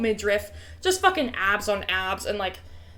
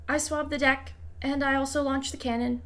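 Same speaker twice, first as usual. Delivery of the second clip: higher, soft and shy; meek little voice, higher than your own; unexpected NPC voice; 3 semitones higher, 6 dB quieter than usual. Acted. Lazan NPC continues speaking.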